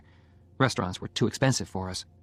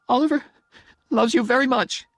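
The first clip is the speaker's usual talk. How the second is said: very high, louder tearful declaration; crying; 14 semitones higher, 6 dB louder than usual. A narrator's reading. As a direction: force the words out through tears. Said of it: "She was crying, so it wasn't easy".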